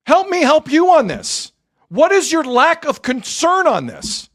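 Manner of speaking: high, slow, exasperated rant; heated rant, pitch way up; angry rant. Real, not acted. Rant at chat about Kawhi.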